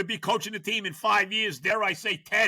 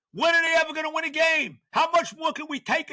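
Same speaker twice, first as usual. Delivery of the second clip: higher, louder fed-up cry; exasperated shout; 9 semitones higher, 3 dB louder than usual. Real, not acted. Fed-up fan yells about the losing Jets, exasperated. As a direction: howl your frustration.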